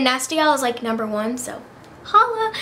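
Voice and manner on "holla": high, excited little cheer; holla shout. Real, not acted. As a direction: toss it out like a happy cheer. Exclaims about her favorite store.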